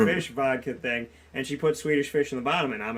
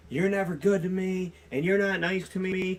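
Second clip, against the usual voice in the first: higher, sing-song whining complaint; childlike drunk ex-wife; 5 semitones higher than usual. Retold or imitated. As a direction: imitate a self-pitying drunk. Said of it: "she got childlike".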